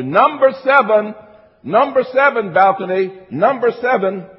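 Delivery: insistent, ringing announcement; calling to the balcony. Real, not acted. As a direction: throw it up to the back seats. Calls the point to the balcony.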